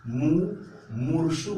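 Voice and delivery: मुमूर्षु said very slowly with a rising tune; slow careful word. Shown as a demonstration. Teacher to exam students, slow demo of मुमूर्षु. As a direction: draw out मुमूर्षु syllable by syllable. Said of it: "माँ पे बड़ा ऊ का मात्रा होता है".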